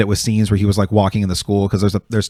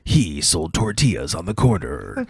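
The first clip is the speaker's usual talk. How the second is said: movie trailer voice; 2 semitones lower, 6 dB quieter, slower than usual. Retold or imitated. Retelling a comedian's trailer bit, slow dramatic narrator. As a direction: draw out each phrase like an epic trailer. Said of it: "this one whole trailer he does".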